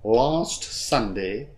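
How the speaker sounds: very slow, stress-timed; slow model reading. Shown as a demonstration. Teacher starts the model sentence slowly. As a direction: draw out each beat. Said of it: "Để chúng ta đọc câu này là".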